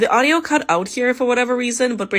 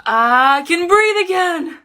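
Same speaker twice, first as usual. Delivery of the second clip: high, loud, slow burst of relief; elated slow shout of relief; relieved cry; 7 semitones higher, 6 dB louder, slower than usual. Real, not acted. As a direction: shout it out like finally free air. Relief after removing the mask.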